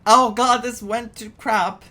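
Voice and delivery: usual speaking voice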